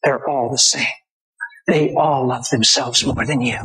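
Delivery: lightly voiced Satan, low dismissal; scoffing Satan. Satan telling God men are selfish, lightly scoffing. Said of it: "And Satan said".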